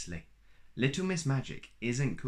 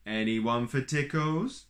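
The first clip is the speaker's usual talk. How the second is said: teasing sing-song; 3 semitones higher, 4 dB louder, slower than usual. A narrator's reading. Mister Tickle teasing.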